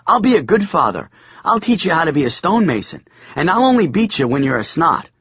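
put-on high, fast, pompous boy; boy playing father. A narrator's reading. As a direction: puff up like a little father. Biff playing the grown-up father.

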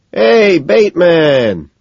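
high, loud, drawn-out hail; slow, hollered greeting; crying out. A narrator's reading. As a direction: call out to a buddy. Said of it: "Van Patten cries out".

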